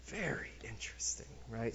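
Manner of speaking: soft, breathy whisper to the class; whispered aside. Real, not acted. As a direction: lean in and whisper it.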